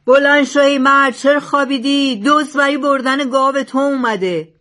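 loud, high alarm call; out-shout a rival to wake a sleeper. A narrator's reading. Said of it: "بلندتر از دزد فریاد کشید".

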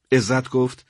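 ordinary delivery

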